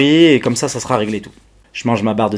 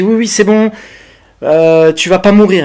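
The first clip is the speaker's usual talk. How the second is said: higher, louder mock reassurance; imitating the operator's breezy reassurance, higher; reassuring operator; 8 semitones higher, 7 dB louder than usual. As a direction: insist cheerfully he won't die. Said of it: "elle me dit".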